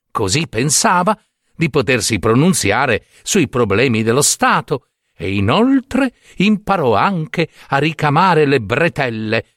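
plain reading voice

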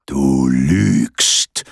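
low, slow rumbling growl; growling giant. A narrator's reading. Giant catches Peter lying, low growl. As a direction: rumble the accusation from deep down. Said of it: "brummte der Riese".

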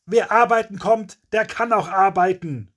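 high, loud, furious yell; crazed bellow. A narrator's reading. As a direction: bellow it like a madman. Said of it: "brüllt Kevin wie verrückt los".

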